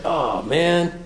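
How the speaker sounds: soft, dejected self-pitying groan. Acted. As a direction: sigh it out, feeling sorry for yourself. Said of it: "in that self-pity voice".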